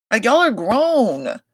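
fed-up reproach; exasperated scolding. Real, not acted. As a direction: lecture them like grown adults. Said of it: "playing these games".

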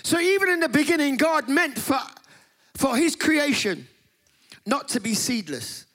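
high, loud preacher's cry; preaching at full force. Real, not acted. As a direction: lift it like a sermon climax. Preaching peak.

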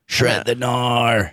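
drawn-out, lightly hammed-up surfer yell; mock surfer call. Host teases co-host about eighties slang, mock surfer call. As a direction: stretch it out like a surf-dude cheer.

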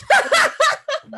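high burst of laughter; real laugh. Real, not acted. Friend laughing at the stranger at the deathbed, a burst of laughter. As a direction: crack up.

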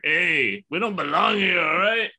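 high, loud Chicano dad impression; Mexican dad voice. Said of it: "will say it to my kids, just like".

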